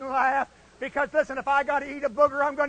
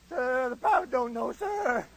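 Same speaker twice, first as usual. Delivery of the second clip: Freeman Spears. Retold or imitated. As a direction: answer helplessly in a small voice. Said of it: "He talked just like that".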